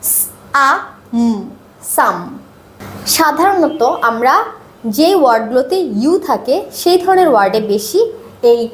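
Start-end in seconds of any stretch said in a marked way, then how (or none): 0.1-2.2 সাম broken into স, আ, ম then blended; sounding out. Shown as a demonstration. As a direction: sound out each part, then join into সাম. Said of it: "আর এটা".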